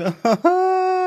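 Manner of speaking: high, loose burst of laughing; high laughter. Real, not acted. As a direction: break into a high-pitched laugh. Laughs at blaming Adam and Eve.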